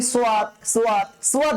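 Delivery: সোয়াদ short, split as "so-ad" with a w glide; mistaken soad. Shown as a demonstration. Teacher quotes a common mistake, glided সোয়াদ. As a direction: break সোয়াদ into two light syllables, so-wad. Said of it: "না উচ্চারণটা".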